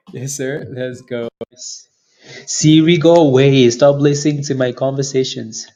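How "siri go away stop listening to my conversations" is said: loud, sharp command at the phone; shouting at Siri. Real, not acted. Streamer shouting at Siri to stop listening, loud. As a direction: tell the assistant off, full voice.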